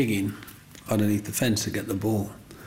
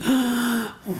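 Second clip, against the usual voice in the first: high gasp of wonder; amazed gasp; 13 semitones higher than usual. Retold or imitated. A neighbour girl sees the ant houses, amazed gasp. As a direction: gasp like a wide-eyed little girl.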